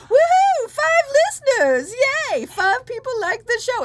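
squealing high-pitched cheer; overjoyed. Retold or imitated. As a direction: shriek with glee. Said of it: "I can actually be".

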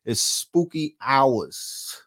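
slow, stretched-out [name]-style line; [name]-style drawl. Retold or imitated. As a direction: drag every word like a [name] hook. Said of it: "Shout out to [name]".

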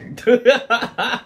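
high, loud burst of laughter. Real, not acted. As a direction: laugh out hard.

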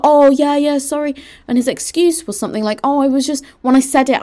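high-pitched imitation of backpedalling; mock excuse. Imitating the friend excusing himself, mockingly.

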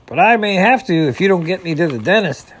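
loud, high-pitched complaint; impatient, pressing demand; indignant beaver. A narrator's reading. Impatient beaver snaps at driver.

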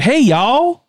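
high, drawn-up American twang; exaggerated American accent. Shown as a demonstration. Example of the American version, exaggerated American accent. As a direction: greet in a hammed-up American y'all accent. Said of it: "the American, like, version of that".

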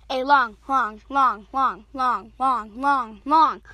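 LONG repeated in a low, even chant; drawn-out repetition. Acted. Storyteller opens story time, rhythmic repeated LONG. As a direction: say each "long" as its own evenly spaced beat.

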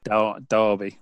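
Derby pronounced "DAR-bee"; Derby as if spelt with an A; local British pronunciation. Shown as a demonstration. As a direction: say Derby like "Darby". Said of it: "it's almost like with an A".

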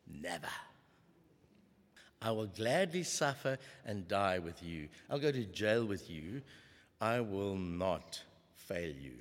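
low, soft and slowing, a solemn promise; quiet earnest vow. Acted. Peter quietly vowing to Jesus he will never fail. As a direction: vow it quietly, deeply sincere.

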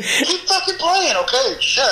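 high, slow, insistent mock command; mock bossy order. DJ imitating his friend ordering him to keep playing, high and slow. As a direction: drag it out like a panicked boss.

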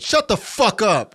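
loud, high, drawn-out yell; yelled retort. Retold or imitated. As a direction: yell it back at a heckler. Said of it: "And I yelled it".

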